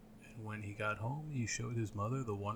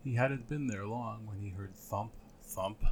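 ordinary delivery, nothing marked

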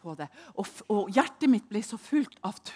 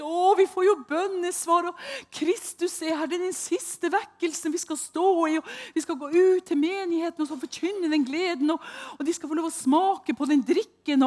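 higher, louder, a lightly mocking voice of the enthralled ones; drowsy believers; 10 semitones higher, 7 dB louder than usual. Mimicking the sleepy deceived believers, mildly mocking rapture. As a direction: ape the deceived, dreamy and rapt. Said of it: "noen var så trøtt".

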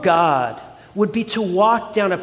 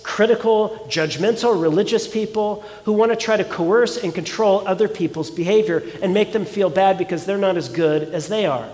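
ordinary talk

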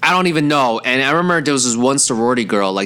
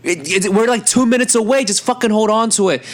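high-pitched mock yell; retold yelling back; 9 semitones higher than usual. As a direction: imitate an exasperated friend yelling back, pitched up. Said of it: "And Keen's yelling back".

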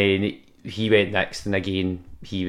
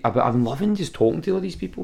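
usual speaking voice

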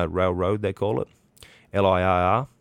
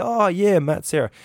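put-on much higher, louder Long Island cabbie accent; the Long Island driver realizing who it is; 12 semitones higher, 7 dB louder than usual. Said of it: "real strong sort of Long Island accent".

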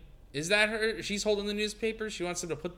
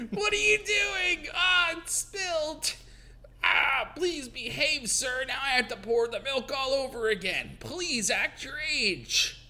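flustered scolding maid; 8 semitones higher, slower than usual. Acted. Mary scolding the old man.

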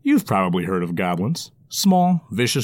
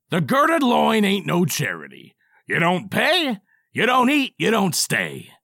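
high, firm barkeep laying down rules; stern dwarf; 10 semitones higher than usual. Acted. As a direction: spell out the house rules. Dwarf character voice.

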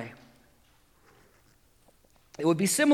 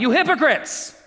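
rising, louder reading of the rebuke; exclaiming; 6 semitones higher, 4 dB louder than usual. Retold or imitated. Pastor reading Jesus rebuking the synagogue leader, exclaimed. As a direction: read it with an exclamation point. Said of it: "Exclamation point".

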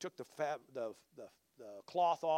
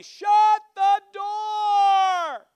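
long, high-pitched holler; neighbor's yell; 13 dB louder, much slower than usual. Retold or imitated. Woman yelling down the street at a stranger, loud drawn-out holler. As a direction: bellow it drawn out across a distance.

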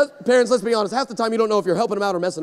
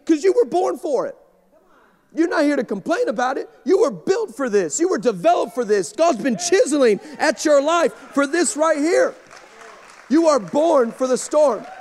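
louder, higher preaching; full-force preaching; 5 semitones higher, 3 dB louder than usual. Acted. Pastor driving home the point to the congregation, preaching hard.

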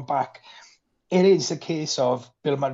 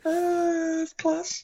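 savouring; much slower than usual. Real, not acted. Savouring the scene.

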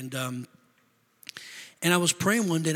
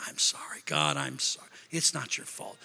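hushed, low, sheepish apology; contrite apology; 9 semitones lower, 7 dB quieter than usual. Acted. Believer apologising to God after an outburst, hushed and contrite. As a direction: drop to a soft, penitent near-whisper.

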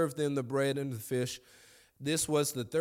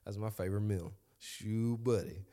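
normal talking voice

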